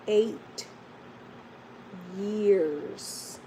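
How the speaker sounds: "Eight", long pause, then "years"; emphatic EIGHT YEARS. Real, not acted. Vlogger marvelling at years of prayer, "Eight years" spaced out emphatically. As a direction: let "Eight" and "years" stand alone, each weighty. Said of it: "I said eight years".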